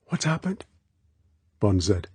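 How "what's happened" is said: put-on high, soft, worried girl's voice; anxious. A narrator's reading. Girl just woken by the alarm, anxious. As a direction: ask anxiously in a small high voice. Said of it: "She said anxiously".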